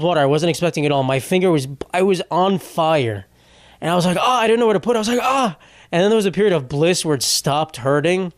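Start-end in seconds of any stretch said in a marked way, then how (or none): 4.2-5.6 high pained yelp, imitated; retold pain yelp. Vlogger reliving burning finger on cup, high yelp. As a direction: recreate the yelp of a burnt finger.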